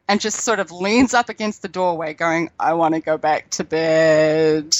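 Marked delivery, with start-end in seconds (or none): 2.6-4.7 put-on half-asleep teenager. Mother imitates her son plodding out, sleepy and slow. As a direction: drag it out like a sleepy fifteen-year-old. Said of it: "very lethargic, very half asleep".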